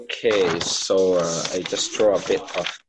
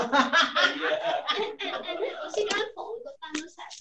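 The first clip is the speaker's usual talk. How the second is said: whispered giggle; 4 dB quieter than usual. Real, not acted. Long whispered laugh.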